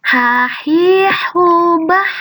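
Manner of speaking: gentle h from the throat, no hiss; soft throat h for the class. Shown as a demonstration. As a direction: breathe the ha out softly from the throat. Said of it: "diucapkannya sangat lembut".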